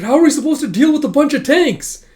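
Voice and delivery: loud, high protesting yell; panicked protest. Acted. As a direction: protest loudly to the commander. Soldier protests to captain.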